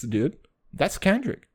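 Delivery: normal talking voice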